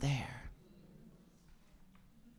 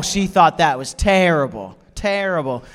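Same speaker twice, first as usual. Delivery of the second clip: ordinary delivery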